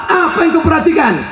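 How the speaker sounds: high, fast, loud mocking question; mocking shout. Preacher mocking the crowd's vanity, loud and high. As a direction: fire the question at them, scoffing.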